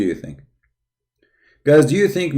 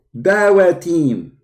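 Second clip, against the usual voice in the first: higher, slow, proud announcement; mock Dawah caller; 6 semitones higher, much slower than usual. Streamer playing the Dawah team introducing itself, hammed-up higher voice. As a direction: puff up like a self-important team.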